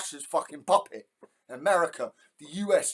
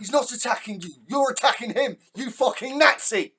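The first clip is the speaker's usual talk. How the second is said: rising furious shout, higher and louder; angry yelling; 7 semitones higher, 3 dB louder than usual. Real, not acted. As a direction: yell it at the screen in real outrage. Reactor yelling at the screen.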